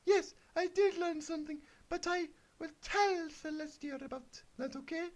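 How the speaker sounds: very high, sweet little pony voice; squeaky Twilight. Acted. Twilight answers the wizard in a very high, happy squeak. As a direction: chirp it like a toy pony.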